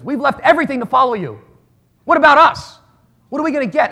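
Peter pleading. Retold or imitated. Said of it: "when Peter said to Jesus".

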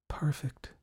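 soft, airy whisper; dreamy whispered aside; whispered aloud. A narrator's reading. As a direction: let it slip out in a whisper. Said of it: "she whispered out loud".

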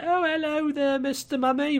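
put-on high-pitched, overdone English accent; hammed-up English greeting in a high voice; exaggerated English accent. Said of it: "not quite that heavy".

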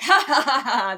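loud, high mock laughter; hammed-up dying laugh; acted defiant cackle. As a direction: cackle boldly like a dying hero. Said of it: "dies laughing, cackling, like".

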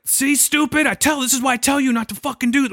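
high-pitched mock scolding; imitated angry yelling. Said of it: "starts yelling at him".

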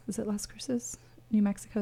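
whispered self-check; uncertain aside. Real, not acted. Radio host double-checking a town's name, whispered.

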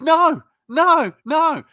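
loud, high, emphatic retold rebuff; emphatic refusal.

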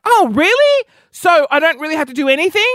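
very high, delighted mock surprise; retold eager surprise. Hears the diet takes minimal effort, eagerly surprised. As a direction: leap up high, then hurry on keenly.

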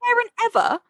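high disbelieving "what parent ever"; incredulous outrage. Retold or imitated. Reacting to Nate's mother, high and incredulous. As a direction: sound appalled. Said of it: "I'm like".

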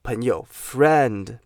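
"friend" drawn out slowly with a clear e sound; slow model word. Shown as a demonstration. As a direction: stretch "friend" for students to repeat. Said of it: "发哎".

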